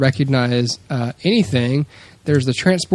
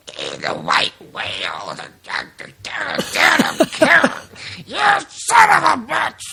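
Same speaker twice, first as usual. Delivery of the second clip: put-on drunken raving; 11 semitones higher, slower than usual. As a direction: ham up a drunk reciting Moby Dick until he explodes. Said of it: "he's drunk in a hotel room, uh, reciting Moby Dick".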